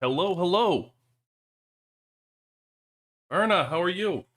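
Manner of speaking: usual speaking voice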